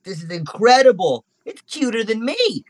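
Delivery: high-pitched wonder; awestruck, pitched well up; amazed little monkey. Acted. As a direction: marvel in a small monkey voice. Monkey marvels at the pet.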